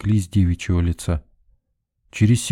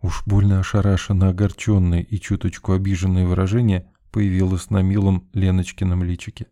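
ordinary delivery

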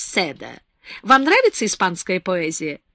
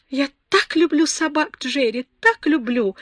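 exclaiming; 6 semitones higher than usual. A narrator's reading. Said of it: "воскликнула Беатрис".

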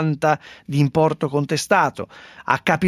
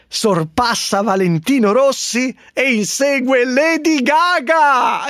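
town crier; 7 semitones higher than usual. Acted. Proclaiming headline like town crier.

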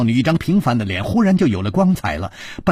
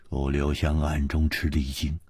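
ordinary delivery, nothing marked

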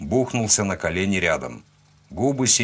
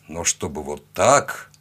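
plain reading voice

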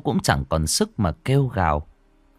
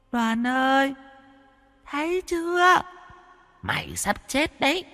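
put-on much higher, excited taunting child ghost; gleeful ghost girl; 13 semitones higher, 4 dB quieter than usual. Ghost girl gloats to dying Đoàn, high and gleeful. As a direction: crow over a dying man. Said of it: "Giọng nói của nó đầy hưng phấn".

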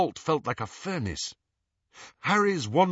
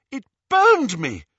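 moaning; 10 semitones higher, 8 dB louder than usual. Acted. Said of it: "moaned Hermione".

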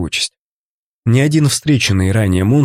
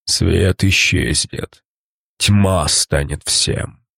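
very slow, lower gurgling prophecy; gurgling monster; 6 semitones lower, 3 dB quieter, much slower than usual. Acted. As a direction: gurgle it out, dying and ominous. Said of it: "пробулькал монстр".

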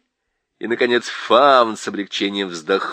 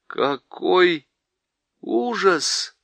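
inner dismay; 6 semitones higher, much slower than usual. A narrator's reading. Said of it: "думал он".

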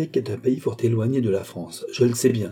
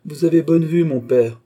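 dry retort, louder and higher; curt; 5 semitones higher, 7 dB louder than usual. A narrator's reading. Son to his insistent father, dry and curt. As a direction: answer back curtly, voice raised. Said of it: "dit sèchement Philippe".

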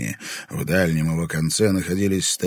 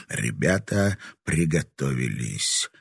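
ordinary delivery

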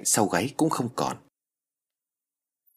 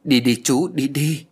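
put-on higher, louder child urging an uncle; insistent, raised-voice plea to go; 4 semitones higher, 5 dB louder, slower than usual. A narrator's reading. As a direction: press him to leave, voice lifted. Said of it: "giục đi".

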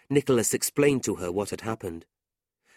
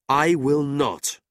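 slow, louder flat refusal; firm refusal; 5 semitones higher, 6 dB louder, slower than usual. A narrator's reading. Nicholas slowly refuses his uncle. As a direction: refuse each word.

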